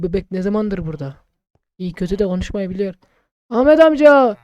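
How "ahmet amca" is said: loud call across. Real, not acted. Player calling shopkeeper Ahmet, loud call. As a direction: shout for the shopkeeper.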